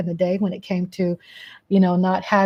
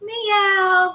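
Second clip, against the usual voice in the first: mock meow; 11 semitones higher than usual. Meowing to the cat.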